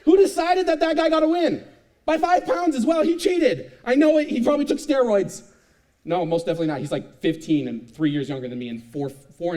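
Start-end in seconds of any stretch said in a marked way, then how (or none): 0.1-5.4 high, loud, furious outburst; indignant, wounded shouting; livid rant. Retold or imitated. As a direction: rage about losing on your birthday. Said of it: "I was livid".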